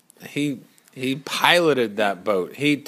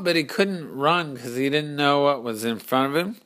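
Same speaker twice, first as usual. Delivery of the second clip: plain reading voice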